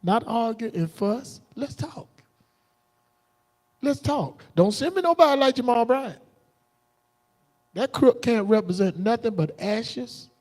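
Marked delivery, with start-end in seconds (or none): none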